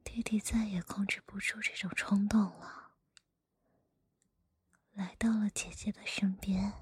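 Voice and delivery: hushed storyteller whisper; whispered story reading. Acted.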